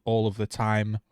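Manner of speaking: normal talking voice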